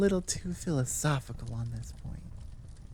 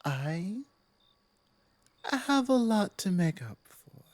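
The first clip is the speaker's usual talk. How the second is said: apologetic werewolf pack leader; 4 semitones lower than usual. Acted.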